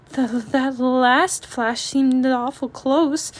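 slow, halting, scared little voice; nervous stammer. Acted. Sister cub nervously telling Papa the flash was close, slow stammer. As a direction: stammer it out, still shaken. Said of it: "said Sister".

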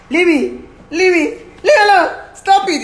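high, loud cries to be left alone; retold angry protest. Woman in a retold fight pushing him off, loud protest. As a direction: act out a heated push-away. Acting out resisting in quarrel.

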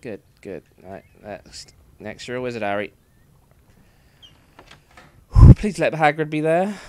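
normal talking voice

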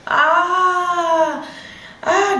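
high drawn-out "aah" imitating screams; imitated scream. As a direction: mimic distant cries of pain, high but not full volume. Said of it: "Gritando, gritando súper fuerte". (grito imitado, agudo y sostenido)

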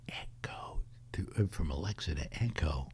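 hushed unvoiced whisper. Real, not acted. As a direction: whisper so the device won't hear. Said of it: "I have to whisper that".

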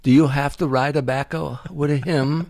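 imitated little old Italian lady; Italian accent. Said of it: "this little old Italian lady".

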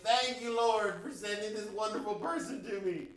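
loud, high exclamation of thanks; overjoyed gratitude. Acted. Stuck driver thanking heaven for being let in, hammed-up relief. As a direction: praise heaven for a hammed-up rescue. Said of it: "I would have been like".

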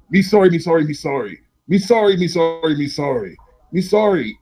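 high, pleading creature babble; groveling creature. Acted. Game master voicing a dim enemy forced to grovel, high pleading. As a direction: repeat it like a simple creature begging.

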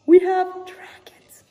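breathless, thrilled whisper; excited whisper. Real, not acted. Showing the dragon poster to viewers, excited whisper. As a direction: whisper it like a secret treasure.

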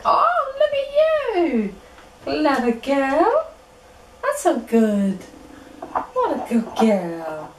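slow, high drawn-out praise; squeaky praising a dog. Acted.

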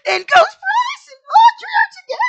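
very high, loud squeal; ecstatic shriek. Real, not acted. Host recaps that the ghosts are together, ecstatic shriek. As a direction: burst into a fangirl shriek.

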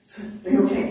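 whispered check-in; breathless whisper. Retold or imitated. Musher checking on Marty after crash, breathless whisper. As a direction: ask in a shaken whisper. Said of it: "I'm like".

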